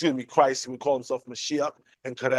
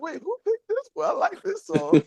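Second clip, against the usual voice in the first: high, pleased mock surprise; squeaky, amused retelling of a surprise; surprised delight. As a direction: act startled, then tickled by the song. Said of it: "I was like".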